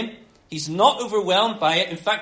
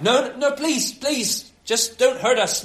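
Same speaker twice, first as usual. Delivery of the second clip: high, urgent, repeated pleas; begging; 6 semitones higher, 3 dB louder, slower than usual. Acted. Demons begging Jesus to let them stay, high pleading. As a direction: beg frantically, pitch jumping up. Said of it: "so they beg him".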